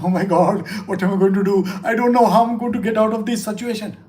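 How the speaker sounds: mock fretting, quick and pressed; distressed panic. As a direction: rush it like a cornered, worried person. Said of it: "feels very distressed about it".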